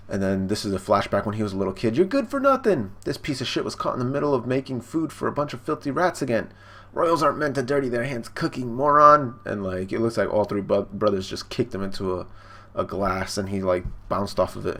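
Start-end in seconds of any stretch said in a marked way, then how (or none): 2.0-9.4 higher-pitched bullying rant; retold sneering insult; scornful berating. Brother berates young Sanji.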